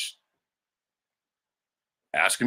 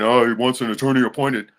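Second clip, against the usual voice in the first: higher, louder mimic of the brother; mocking brother voice; 5 semitones higher, 9 dB louder than usual. Mocking the brother's line to the court. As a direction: sneer out the brother's words. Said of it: "my brother keeps saying".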